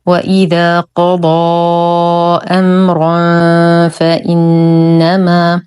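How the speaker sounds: slow, drawn-out tajweed recitation; Quran recitation. Shown as a demonstration. Tajweed teacher models the verse for learners, slow measured recitation. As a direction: recite with long held vowels and nasal hums.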